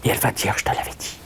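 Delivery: hushed unvoiced whisper. Shown as a demonstration. Medium voicing the little inner voice to the audience, whispering.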